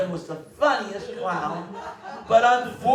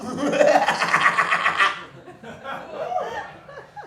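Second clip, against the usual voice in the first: clown's cackle; 6 semitones higher than usual. Retold or imitated. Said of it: "That's my imitation of him".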